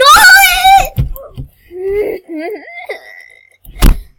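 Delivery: long loud high wail; real exasperated wail; tearful scream-laugh. Real, not acted. Failing again in game.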